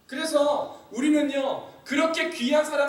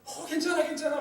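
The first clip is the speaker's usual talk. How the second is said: put-on higher, gentle soothing of a hurt child; 6 semitones higher than usual. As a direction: coax the crying child calmly. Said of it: "달래고 얼르고 하면서". (우는 아이를 달래는 엄마, 높고 부드럽게 달래는 목소리)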